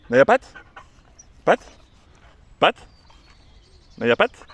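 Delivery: sharp loud high call to the dog; loud command. Real, not acted. Trainer ordering Naya to give her paw, loud and high. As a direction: bark out the order.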